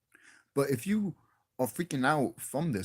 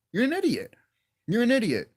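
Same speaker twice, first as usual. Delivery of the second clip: higher-pitched, emphatic scolding; exasperated insult; 9 semitones higher, 5 dB louder than usual. Real, not acted. YouTuber berating hypocritical podcasters, exasperated and emphatic. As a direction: hammer the insult home, repeating it.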